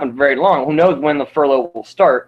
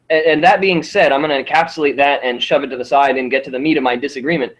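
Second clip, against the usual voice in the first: ordinary talk, nothing marked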